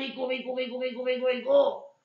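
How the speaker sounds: normal talking voice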